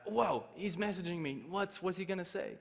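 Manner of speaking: high, suspenseful wonder; anxious excitement. Retold or imitated. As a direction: react eagerly to the awaited reply. Anxiously awaits friend's message appearing.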